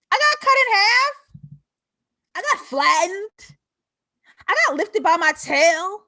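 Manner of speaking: high, loud defiant cries; triumphant. Real, not acted. Speaker rallying viewers, loud triumphant defiance. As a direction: shout each hit like a victory. Triumphant list of survived hits.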